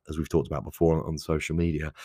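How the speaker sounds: ordinary talk, nothing marked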